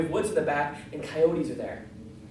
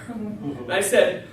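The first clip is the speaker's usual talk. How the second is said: ordinary talk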